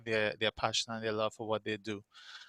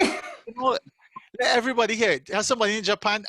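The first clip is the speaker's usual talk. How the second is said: playful coaxing; 9 semitones higher, 6 dB louder than usual. Real, not acted. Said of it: "host teasing guest excitedly".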